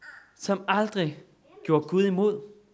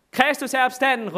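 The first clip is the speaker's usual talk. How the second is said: high, loud Easter proclamation; priests calling out; 8 semitones higher, 4 dB louder than usual. Retold or imitated. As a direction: call it out like a priest at the church door. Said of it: "råber de igen".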